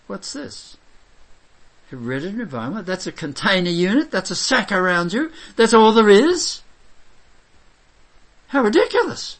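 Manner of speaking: mock the idea until it bursts out; scoffing disbelief, pitch climbing high; incredulous mockery. Mocking the counsellor's view.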